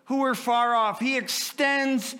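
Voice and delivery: high, loud, slow, on the edge of tears; impassioned preaching. Acted. Preacher overwhelmed by God's love, voice breaking.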